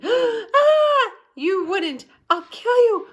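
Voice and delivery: put-on girl shrieking, near tears; screaming girl. A narrator's reading. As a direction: scream at the boy to give it back. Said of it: "Sheila screamed".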